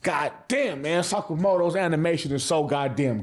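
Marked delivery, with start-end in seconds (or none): none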